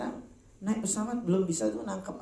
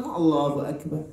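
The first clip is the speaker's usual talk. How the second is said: slow prayer call, slightly louder; mock prayer call; 5 dB louder than usual. Man mimics opening his prayer in slow chant. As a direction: intone it like the start of prayer. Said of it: "pas sholat".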